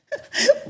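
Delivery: a short high laugh; a quick disbelieving laugh. Real, not acted. Retelling the mask insult, a short high laugh.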